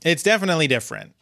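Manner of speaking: ordinary delivery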